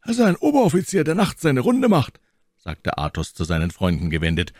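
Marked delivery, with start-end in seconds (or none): 0.0-2.2 hurried aside to friends. A narrator's reading. Athos aside to his friends.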